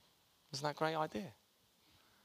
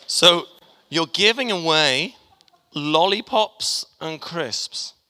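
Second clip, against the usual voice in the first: excited announcing; 6 semitones higher, 8 dB louder, slower than usual. Real, not acted.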